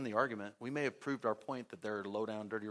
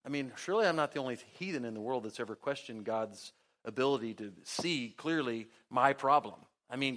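ordinary talk

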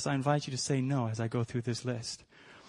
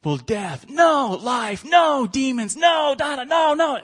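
high, loud shouts of no, one after another; screamed list; 12 semitones higher, 7 dB louder, slower than usual. Retold or imitated. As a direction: shout each no much higher and louder. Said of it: "we screamed our heads off in there".

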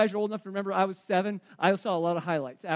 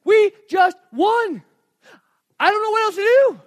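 ecstatic coach yelling, much higher and louder; a coach who just won, shouting with joy; Jim Valvano going nuts; 12 semitones higher, 13 dB louder than usual. Retold or imitated. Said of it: "He's like".

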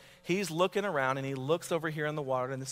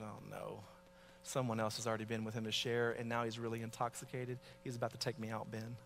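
much softer, fast worried thought; inner panic; 4 semitones lower, 9 dB quieter than usual. Retold or imitated. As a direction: mutter a panicked private thought. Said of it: "That's what I thought".

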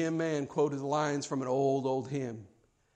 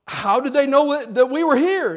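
raised, loud incredulous question; baffled demand; 8 semitones higher, 9 dB louder than usual. Retold or imitated. Young preacher questioning Jim, baffled and fuming. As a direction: demand an explanation, pitched high. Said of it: "fuming, and now he's confused".

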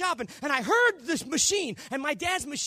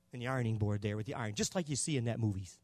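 normal talking voice

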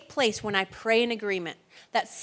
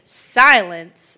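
commanding rebuke; 4 semitones lower, 10 dB louder than usual. Retold or imitated. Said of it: "he said to the waves".

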